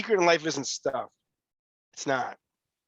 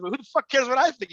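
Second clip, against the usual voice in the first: higher and louder, half-laughing retort; retold outburst; 10 semitones higher, 5 dB louder than usual. Retelling his blunt reply to the young guy, a loud high retort. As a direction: fire it back at him, much higher and louder.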